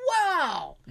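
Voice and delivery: retold amazed reaction, falling from high. Friend reacting to her knee snapping, amazed.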